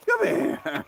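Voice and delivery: high sing-song call to the dog; coaxing the dog. Real, not acted. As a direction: lift it up high to coax him over. Coaxing dog to come over.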